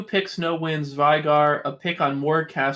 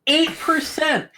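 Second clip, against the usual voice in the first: high exasperated outburst; 12 semitones higher, 3 dB louder, slower than usual. Real, not acted. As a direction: blurt it out in disbelief. Exasperated at stat.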